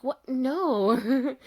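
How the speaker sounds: mock nervous denial, high and loud; imitating a flustered, nervous laugh. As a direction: act out a loud protest breaking into a nervous laugh. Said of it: "Like, nervous laugh".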